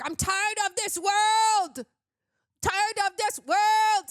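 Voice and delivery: hammed-up, slow, high shout, swelling on "world"; mock angry outburst. Reviewer imitating the film's lead faking anger, hammed-up angry shout. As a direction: imitate a bad actor replicating anger. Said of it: "where someone gets angry".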